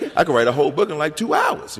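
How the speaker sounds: giggling at his own lazy plan; laughing self-mockery. Young poet mocking his own shortcut plan, laughing. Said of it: "I'm looking for the shortcut always".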